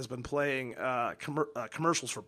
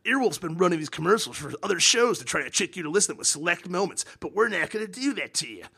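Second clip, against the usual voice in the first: mock podcast promo; 4 semitones higher, 5 dB louder than usual.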